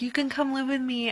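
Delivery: lifted, kindly Sirius offer; a light imitation of Sirius inviting Harry; quoting Sirius.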